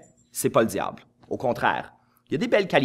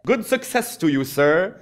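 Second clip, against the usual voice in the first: plus haut et plus fort, un anglais guindé; mock posh English; 6 semitones higher, 5 dB louder, slower than usual. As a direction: singe un gentleman anglais pompeux. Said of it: "ça se dit vraiment horriblement en anglais". (anglais pompeux de moquerie, plus haut et fort)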